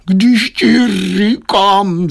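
high, very slow wail; long drawn-out, high-pitched howl of protest; wailing husband. A narrator's reading. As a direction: whine it out in exasperation. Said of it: "zakvílel obchodník".

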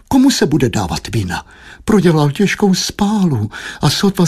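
high, fretful motherly lament; plaintive high nagging; moaning wife. A narrator's reading. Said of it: "sténala manželka".

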